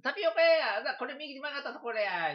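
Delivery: high, loud cry of discovery; light up at finding the shop; excited discovery. Retold or imitated. Retelling finding the tapioca shop. (店を見つけて高く大きく喜ぶ声)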